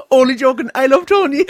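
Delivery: high, loud imitation; mock gushing backtrack. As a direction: cry it out in a high character voice. Acting out character's sudden reversal.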